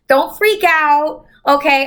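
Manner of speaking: usual speaking voice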